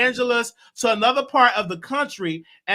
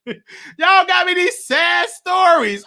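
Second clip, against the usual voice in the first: laughing; 8 semitones higher, 5 dB louder, slower than usual. Real, not acted. Host laughing about sad stories.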